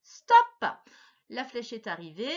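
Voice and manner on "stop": high, loud shout of top and stop; teacher calling the timer; loud countdown call. Real, not acted. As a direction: start and stop the clock with a shout. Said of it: "timed reading start and stop".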